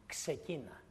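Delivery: God's quiet urging to Gideon; soft command. Retold or imitated. God tells Gideon softly to start, quiet urging. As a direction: gently say start. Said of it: "Του λέει".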